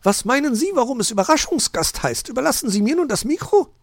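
high, loud magpie voice; snippy, high-pitched bird lady; Frau Elster. A narrator's reading. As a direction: challenge him in a very high voice.

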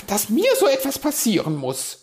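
high, loud self-reproach; scolding herself. A narrator's reading. Magpie cursing her own clumsy mistake, scolding. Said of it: "schimpfte sie mit sich selbst".

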